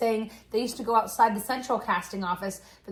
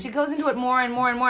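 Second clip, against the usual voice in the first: ordinary talk, nothing marked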